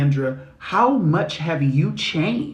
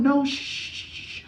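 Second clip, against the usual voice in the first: hushing; 10 semitones higher, 7 dB quieter than usual. Retold or imitated. Hushing imagined fussers.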